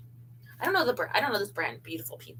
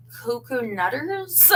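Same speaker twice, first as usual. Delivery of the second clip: slow; much slower than usual. Real, not acted. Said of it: "what would you call me".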